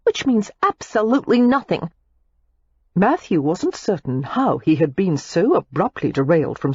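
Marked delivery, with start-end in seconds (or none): 0.0-1.9 put-on higher, scornful young woman; scornful Daisy. A narrator's reading. Daisy scorns Matthew's kiss on the hand. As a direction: dismiss his kiss with scorn. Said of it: "Daisy said scornfully".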